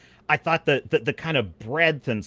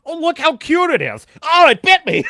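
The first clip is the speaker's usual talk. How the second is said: high, loud cooing then yelp; mock coo turning to a yelp; mock naive captain; 11 semitones higher, 9 dB louder than usual. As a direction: play a clueless captain cooing then getting bitten. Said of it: "if Captain Dallas ... had wanted to pet".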